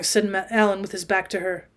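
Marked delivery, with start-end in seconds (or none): none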